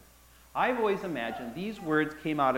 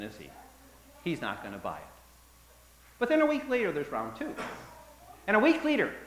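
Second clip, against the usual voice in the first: ordinary delivery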